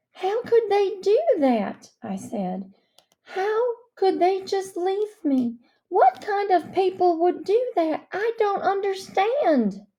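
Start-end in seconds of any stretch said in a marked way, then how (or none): 0.1-1.9 high, anguished, pleading; distraught cry. Acted. Girl asking the minister why her parents left her, distraught and high. Girl upset about abandonment. 3.2-9.9 high, anguished, pleading; distraught cry. Acted. Girl asking the minister why her parents left her, distraught and high. Girl upset about abandonment.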